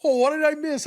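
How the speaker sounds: high-pitched retold astonishment; startled surprise. Telling co-host about looking up from dishes, startled surprise. Acts out own startled reaction.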